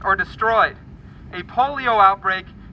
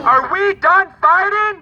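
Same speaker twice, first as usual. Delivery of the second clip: slow, high, loud call to the crowd; shouted rally call; 12 semitones higher, 8 dB louder, slower than usual. Real, not acted. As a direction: rouse the crowd. Call and response.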